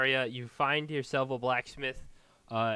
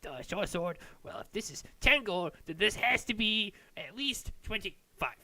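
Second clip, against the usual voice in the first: haggling dwarven smith; 10 semitones higher than usual. Acted. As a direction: bargain carefully, correcting himself. Smith pricing short sword.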